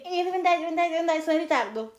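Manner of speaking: high nagging sing-song; mocking whine. Mocking her friend's hurry, high whine. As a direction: imitate her rushing excuse. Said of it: "per non sentirti dire".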